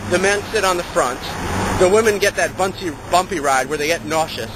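usual speaking voice

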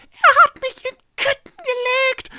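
very high, loud strangled outburst; furious hiss. A narrator's reading. Echo protests being chained, furious. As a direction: spit it out at full force, high-pitched. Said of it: "zischte Echo".

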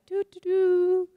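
slow, high, loud hummed doo doo doo; mock oblivious humming. Speaker mimics a too-nice Utahn ignoring the open zipper, pretending obliviousness. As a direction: hum as if you noticed nothing. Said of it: "We'd be like".

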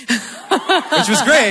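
said through a real laugh; high, laughing, amused aside. Real, not acted. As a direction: laugh it out, tickled by the reply. Laughs recalling funny email reply.

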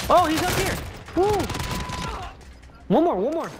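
high, excited callout; hyped gamer bro. Real, not acted. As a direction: hype up the final kill. Hyped calling out enemies.

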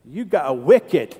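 imitated Boston townie, drawn-out words; slow mock-Boston delivery; Boston accent. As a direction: stretch it out like a Boston local. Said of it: "that's how they would say it".

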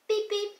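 high, short imitated beeps; high beeping. Boys' gang arriving, high beep-beep noise. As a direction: beep like a little horn.

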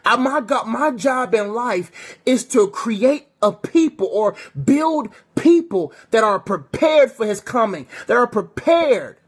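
fervent preacher building intensity; preaching. Acted. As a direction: preach it out to the congregation.

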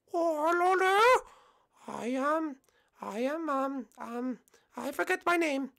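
high, halting falsetto; drawn-out greeting, then hesitant high voice; put-on falsetto, losing the thread; forgetful contestant.

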